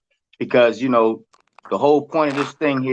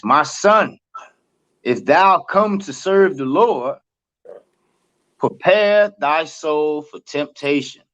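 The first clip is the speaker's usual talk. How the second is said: scripture reading; 3 semitones higher, slower than usual. Acted. Reads scripture aloud slowly.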